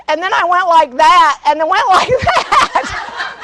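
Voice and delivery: high, slow words dissolving into laughter; laughing helplessly through the last words; breaking into laughter. Real, not acted. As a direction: crack up while telling it. Breaks up laughing at own mishap.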